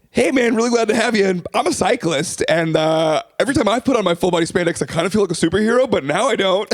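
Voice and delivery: mock-earnest impression of an offended cyclist; imitated hurt cyclist. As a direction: play the polite guy airing his hurt, picking up speed. Acts out booker confronting him after show.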